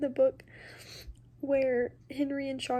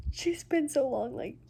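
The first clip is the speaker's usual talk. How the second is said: normal talking voice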